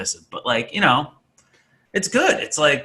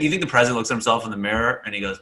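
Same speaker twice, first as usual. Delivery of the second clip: ordinary delivery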